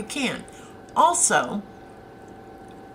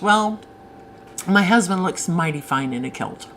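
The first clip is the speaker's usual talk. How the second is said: usual speaking voice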